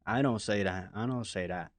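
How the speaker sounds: murmured repeat; fading repeat. Real, not acted. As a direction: trail off repeating.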